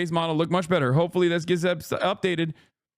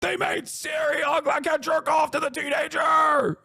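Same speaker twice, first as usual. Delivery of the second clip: high, shouted whiny mock tantrum; outraged fanboy; 7 semitones higher than usual. Streamer mocking gamers angry about Ciri's looks, hammed-up outraged shout. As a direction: wail it in mock outrage, drawing out the words. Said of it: "these guys are like".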